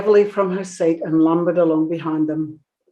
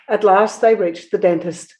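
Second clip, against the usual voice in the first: ordinary delivery, nothing marked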